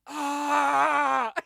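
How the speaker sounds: long, loud raspy "ah", pitched high; imitated pained scream. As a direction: imitate a wrestler screaming in pain. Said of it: "raspy voice".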